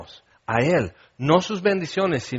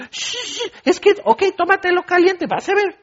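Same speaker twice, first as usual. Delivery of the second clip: very high mock parent hushing, with a laugh; exasperated parent. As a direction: hush the baby, then give in laughing. Parent giving up to baby.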